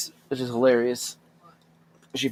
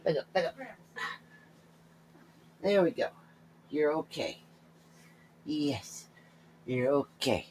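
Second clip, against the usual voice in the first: soft reassuring pet talk; calm the scared cat softly; soothing coo; 8 dB quieter, slower than usual. Real, not acted. Picking up his frightened cat, soft soothing.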